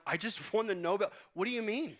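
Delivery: high, loud incredulous protest; exasperated disbelief. Retold or imitated. Grandson to Papaw about his Nobel, high exasperated disbelief. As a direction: pitch it up, can't believe he missed it. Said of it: "I'm like".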